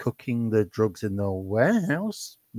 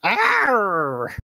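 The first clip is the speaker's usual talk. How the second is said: pirate arr; 8 semitones higher, 4 dB louder than usual. Acted. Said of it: "that didn't come out right".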